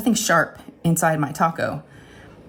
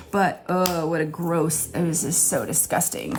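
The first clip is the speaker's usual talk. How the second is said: softer, disgusted groan; 3 dB quieter, slower than usual. Real, not acted. Recalling the taco, disgusted. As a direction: recoil from the memory.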